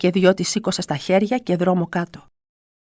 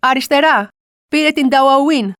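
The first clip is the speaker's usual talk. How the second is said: slow, louder shouted directions; calling directions; 4 semitones higher, 6 dB louder, slower than usual. Retold or imitated. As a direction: point the way loudly. Passerby calls directions.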